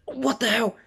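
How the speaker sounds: high-pitched startled squawk; retold disbelief, voice jumping way up; shocked outcry. Fan acting Deku facing Ida's burst, high shocked cry. Character shocked by a speed move.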